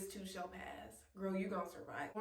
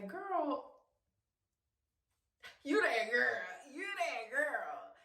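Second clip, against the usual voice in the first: drawn-out, higher, proud cheer; self-hyping; 4 semitones higher, 3 dB louder, slower than usual. Acted. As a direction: hype yourself up like your own best friend. Hyping herself up in the mirror.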